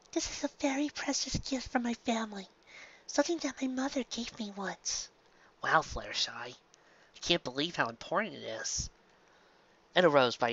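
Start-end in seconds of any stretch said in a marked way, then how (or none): 0.1-5.1 much softer and higher, gentle and shy; soft shy pony voice. Acted. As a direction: speak softly like a timid pony. Fluttershy-like character in preview.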